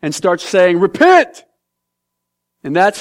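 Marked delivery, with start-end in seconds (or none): 0.8-1.4 high, loud street-preacher cry; preacher's shout. Acted. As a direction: call out to the whole city.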